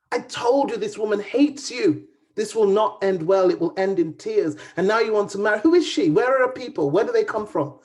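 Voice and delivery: loud high harangue, rising to a cry; a mother raging at her son; angry scolding. Acted. Mother scolds son over marriage.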